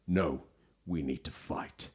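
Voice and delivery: low, quiet, dramatic hero; grave mock-movie delivery; trailer hero impression. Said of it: "That's a great impression".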